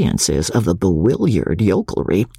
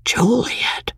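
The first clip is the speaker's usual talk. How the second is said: stunned, breathless whisper; barely breathes the name in shock; 10 semitones higher than usual. A narrator's reading. Husband reading his wife's farewell note, stunned whisper. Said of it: "in a stunned way".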